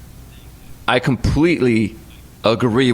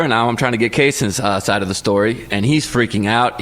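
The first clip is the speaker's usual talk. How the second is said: ordinary delivery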